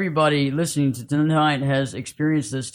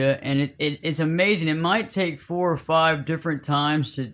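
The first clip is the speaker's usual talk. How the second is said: ordinary talk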